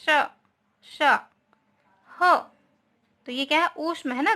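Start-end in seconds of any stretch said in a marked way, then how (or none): 0.0-2.3 श, श, ह hissed out alone as bare sounds; isolated consonant sounds for the class; sound demo. Shown as a demonstration. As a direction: sound out श, श and then a breathy ह. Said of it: "इन व्यंजनों का उच्चारण करते समय".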